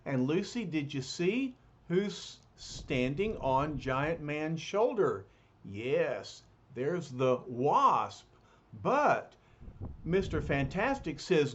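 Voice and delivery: ordinary delivery, nothing marked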